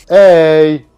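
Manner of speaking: loud, drawn-out hey; protesting shout. Real, not acted. Player objecting to teammate mid-game, loud shout.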